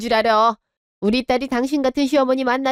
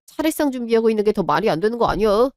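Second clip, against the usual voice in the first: ordinary talk, nothing marked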